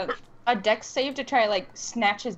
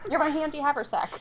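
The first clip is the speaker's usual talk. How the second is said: laughing; 7 semitones higher than usual. Real, not acted. Group cracking up at joke.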